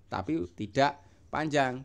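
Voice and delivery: ordinary delivery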